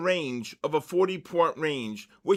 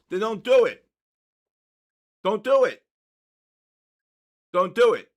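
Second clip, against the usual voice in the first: louder and higher, hammering each word; forceful repeated warning, not quite shouting; emphatic near yell; 4 semitones higher, 7 dB louder than usual. Real, not acted. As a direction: drive the warning home hard.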